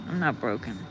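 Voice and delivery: softly, low and to herself; quiet repeat. Real, not acted. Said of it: "But I'm not broken".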